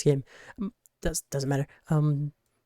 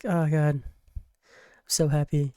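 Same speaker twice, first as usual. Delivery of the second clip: ordinary talk, nothing marked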